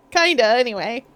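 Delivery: ordinary talk, nothing marked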